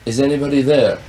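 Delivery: calling out. Real, not acted. Interviewer calls to the poltergeist for an answer, calling out.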